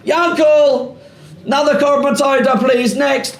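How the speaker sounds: busy priest shouting the next offering; calling out. Pretend. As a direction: summon Yankel over the crowd.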